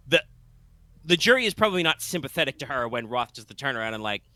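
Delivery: normal talking voice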